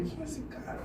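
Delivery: breathy private whisper of surprise. Retold or imitated. Sizing up the guitarist with the nails, whispered aside. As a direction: hush it as a thought to yourself. Inner thought on seeing guitarist.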